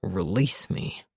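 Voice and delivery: deep, slow, quiet command; low commanding voice. A narrator's reading. Revan commands.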